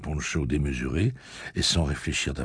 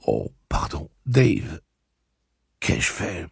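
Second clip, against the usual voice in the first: shocked apology; 3 semitones higher, slower than usual. A narrator's reading. Horrified apology to the man she shot.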